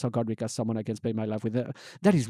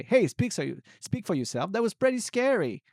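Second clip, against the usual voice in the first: put-on higher-pitched, mild protest from a baby; a hint of an indignant baby talking back; lightly acted baby complaining to mother; lightly voiced unborn baby; 7 semitones higher than usual. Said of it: "as if to say".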